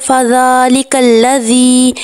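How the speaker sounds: فَذَلِكَ الَّذِي with the long vowel held; slow tajweed with madd drawn out; long vowel recitation. Shown as a demonstration. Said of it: "ذال کو لمبا کر کے پڑھنا ہے". (مد کے ساتھ لمبا کر کے)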